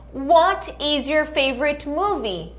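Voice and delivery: slow, clear teacher model; "What is your favorite food?" said slowly word by word; slow repeat-after-me. Shown as a demonstration. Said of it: "Repete comigo".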